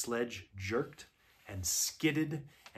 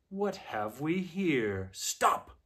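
imperious witch; 4 semitones higher, much slower than usual. A narrator's reading. Witch spots a party from her sledge, imperious. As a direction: draw out the question, then command a halt.

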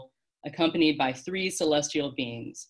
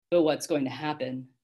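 plain reading voice

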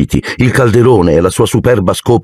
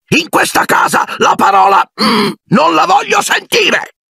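furious shout. Acted. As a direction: forbid the word with a roar. Uncle Vernon shouting. (urlo furioso, lento e altissimo)

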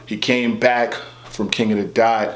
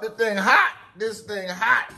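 higher, pained "this thing hot"; burned-mouth complaint; 9 semitones higher, 4 dB quieter than usual. Acted. Griping to Billy Baby about the hot thing, pained and high. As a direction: complain about the heat like it stings.

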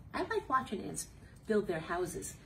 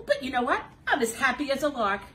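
loud, fast cheerful burst; happy as a lark; happy excited; 5 semitones higher, 11 dB louder, faster than usual. Acted. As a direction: bounce it out happily.

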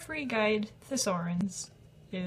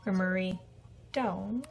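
ordinary delivery

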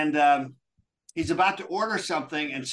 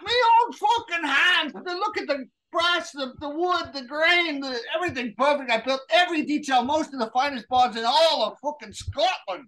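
Scottish brogue rant; 4 semitones higher than usual. Acted. Barroom Scot complaining to a stranger, heated high Scottish brogue. McGregor's aggrieved bar rant.

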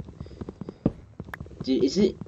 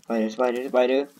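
ordinary talk

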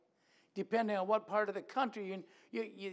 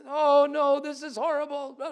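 higher and much louder, hammed-up wail; mock dismay; 6 semitones higher, 10 dB louder than usual. Preacher imitates an emotional yo-yo in sudden despair, loud and high. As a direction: cry it out like the sky is falling. Mocking yo-yo's next low.

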